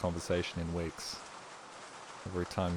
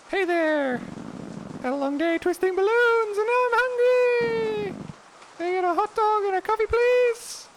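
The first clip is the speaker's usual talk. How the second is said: very high, loud squeaky clown; silly clown voice; 7 dB louder than usual. Acted. Clown customer ordering at the food stand, squeaky high voice. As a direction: chirp it like a cartoon clown. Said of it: "I just did a silly voice for you".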